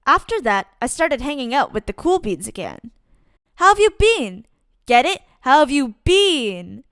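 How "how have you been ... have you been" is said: higher, louder punchline; pun delivered. Acted. Narrator tells the cool beans his bean pun, playful and loud.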